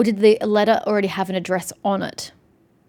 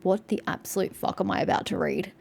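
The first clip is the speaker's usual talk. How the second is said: usual speaking voice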